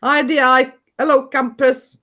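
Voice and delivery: put-on lilting Welsh accent announcing over the tannoy. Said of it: "And I sent it to Welsh accent".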